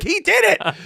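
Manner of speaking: very high, squealed-up defence; protesting. Retold or imitated. Comedian blaming the director to yelling relatives, protesting. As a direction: shift blame in a high mock protest.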